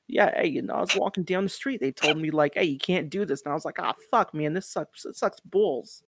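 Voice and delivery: hammed-up loud New Yorker storyteller. Mock tale of walking down the street, New Yorker accent. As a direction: play a griping New Yorker. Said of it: "that very New Yorker".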